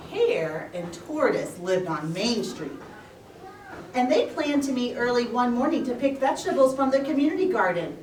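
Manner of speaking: ordinary delivery, nothing marked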